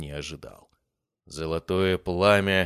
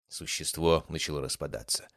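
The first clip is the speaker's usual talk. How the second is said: ordinary delivery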